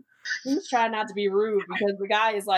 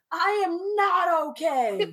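high, slow mock wail; hammed-up tearful declaration; sobbing moviegoer; 6 semitones higher, slower than usual. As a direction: drag it out as if inconsolable. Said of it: "And then he says".

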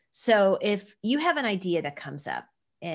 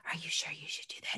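unvoiced doubting whisper; whispered inner voice; 13 dB quieter, faster than usual. Inner doubt whispering to herself, hushed. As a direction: breathe the worry like a quiet inner voice. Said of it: "turn these voices down to the whispering".

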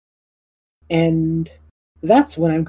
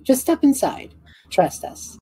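coaxing NPC; 10 semitones higher than usual. Acted. NPC coaxing player inside.

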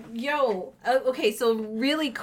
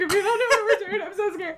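panicked; 10 semitones higher, 4 dB louder, faster than usual. Retold or imitated. Panicked warning to friend.

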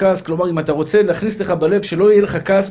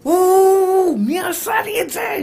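very high, drawn-out howl; hammed-up pained outcry; 13 semitones higher, much slower than usual. Preacher mimics a hurt man seeking a culprit, high howling outcry. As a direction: wail it high like someone hurt and indignant.

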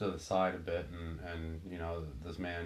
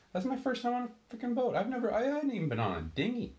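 exasperated outburst; 3 dB louder than usual. Real, not acted. Exasperated at his own inexperience.

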